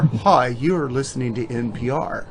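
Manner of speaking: soft, subdued public-radio newsreader parody; mock dull radio bulletin, quietly delivered; NPR host. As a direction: read the news in a hushed, sleep-inducing voice. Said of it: "remember N P R?".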